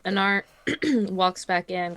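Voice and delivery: ordinary delivery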